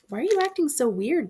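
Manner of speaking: a father pressing his odd son; demanding dad. A narrator's reading. Dad demands to know why his son acts weird, insistent. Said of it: "Dad demanded".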